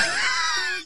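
shrill burst of laughter; high squeal laugh. Real, not acted. Squealing laugh mid story.

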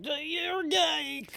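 high, drawn-out jeer; exaggerated slow taunt; mock taunt. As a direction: stretch it out in a high mocking voice. Mocks repressed accent speaker's taunt.

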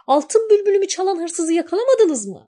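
high, loud accusing question; angry shout. A narrator's reading. Girl confronts spring and tree, high loud demand. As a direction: demand answers from the guards. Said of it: "diye bağırmış".

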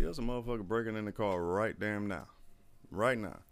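ordinary talk, nothing marked

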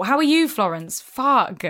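ordinary talk

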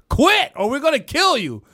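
high, loud ultimatum; hammed-up yelled warning; mock threat. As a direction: threaten the old boss, playfully overdone. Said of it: "It's like, yo".

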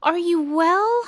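high, rising run of worried questions; anxious cry. A narrator's reading. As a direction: plead to know they are safe. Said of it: "cried the elephant".

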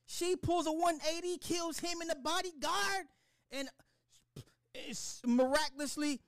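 high-pitched, incredulous rant; spazzing out. Real, not acted. Fan spazzing to viewers over the show's ending, incredulous high-pitched rant. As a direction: stammer it out high in sheer disbelief. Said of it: "spazzing like this".

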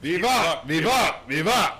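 loud, high shouts of viva; cheering. Real, not acted. Group toast cheer.

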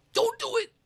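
very high squeaky plea; mock pleading warning. Warning inmates off the deadly food, mock pleading warning.

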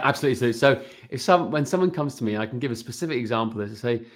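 ordinary delivery

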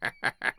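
short, hushed chuckle; soft voiceless chuckle; noiseless laugh. A narrator's reading. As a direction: laugh almost silently in quick little puffs. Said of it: "laughed in the hearty, noiseless fashion".

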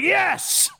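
high-pitched imitation of a fired-up crowd; mock rally cheer. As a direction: cheer like a rally fanatic, mockingly. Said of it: "like a fucking Trump rally".

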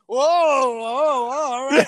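drawn-out, loud whoas; loud protest. Real, not acted. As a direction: cut in loudly to call a halt. Co-host's rant cut off in protest.

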